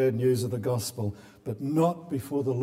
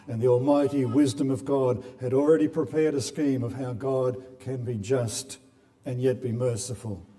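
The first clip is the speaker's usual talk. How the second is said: ordinary delivery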